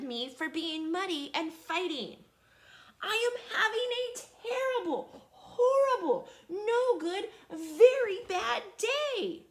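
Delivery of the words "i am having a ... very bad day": much higher and louder wail, slowing at the end; loud high crying howl, breaking into a cry. Acted. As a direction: sob it out like a child who has had enough. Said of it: "when I started crying".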